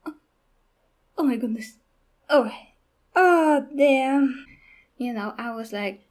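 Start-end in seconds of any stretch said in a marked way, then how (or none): 0.0-4.5 imitating breaking down in the cinema; overwhelmed gasping. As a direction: let it swell into a loud, choked-up outburst. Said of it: "and I'm like".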